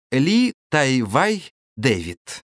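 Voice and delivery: ordinary talk, nothing marked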